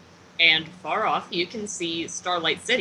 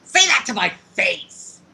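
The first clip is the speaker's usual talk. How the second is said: loud, raised acted outburst; confrontational challenge; 6 semitones higher, 7 dB louder than usual. Ratcher challenging whoever just insulted her, confrontational. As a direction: throw it out as a heated dare. Character's angry challenge in roleplay.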